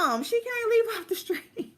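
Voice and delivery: high squeal of laughing disbelief; cracking up while blurting it out. Retold or imitated. Laughing at the host's mistake in disbelief. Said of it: "So I'm like".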